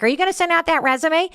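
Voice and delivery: high fast nag; nagging. Retold or imitated. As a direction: needle him about it. Nagging spouse about job.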